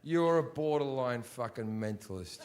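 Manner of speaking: usual speaking voice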